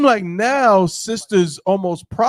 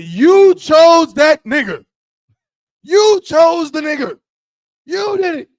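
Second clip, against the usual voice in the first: higher, louder shout; 8 semitones higher, 9 dB louder than usual. Real, not acted. Man scolding the sisters about their choice, shouting. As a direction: call them out at full volume. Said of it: "Oh, shit".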